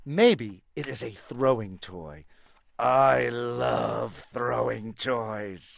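very slow, stretched-out elephant voice; dreamy elephant. Acted. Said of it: "me as the elephant".

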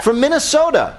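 slipping into a fake Minnesotan accent; hammy Minnesotan accent, high and sing-song. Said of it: "my awful fake Minnesotan accent".

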